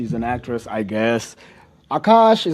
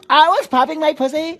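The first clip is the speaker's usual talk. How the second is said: mock wife quote; 9 semitones higher than usual. Podcaster mocks the wife's old brag in a high voice. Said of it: "And she's like".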